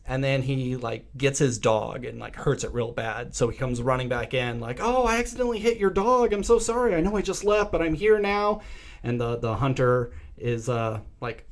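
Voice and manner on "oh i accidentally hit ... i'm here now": higher, put-on sorry voice; imitating a fake apologetic visitor; mock fake apology. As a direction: pretend to be sorry for hitting the dog. Villain faking an apology.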